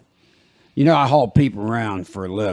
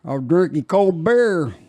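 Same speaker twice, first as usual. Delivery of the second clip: slow, higher mock country twang, like spoofing a country lyric; mock country song; 6 semitones higher, slower than usual. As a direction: parody a sad country song line in a lightly put-on twang. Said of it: "It's like".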